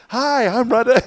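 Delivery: high, loud mock-cheerful greeting; forced smile voice. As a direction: beam a goofy fake smile. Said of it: "I was in the mirror like".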